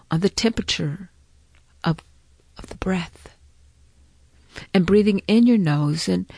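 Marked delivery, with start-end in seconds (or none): 2.6-3.4 whispering. Acted. Soft guidance.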